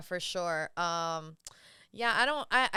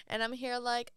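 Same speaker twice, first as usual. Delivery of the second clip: usual speaking voice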